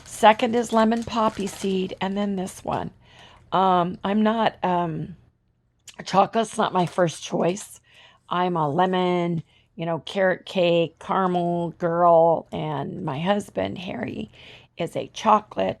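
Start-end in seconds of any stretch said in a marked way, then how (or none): none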